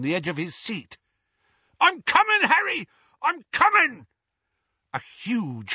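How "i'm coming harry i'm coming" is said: high, loud calls to someone falling; high-pitched rescuer's shout; urgent yell. A narrator's reading. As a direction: holler that help is on its way. Hagrid yells to falling Harry.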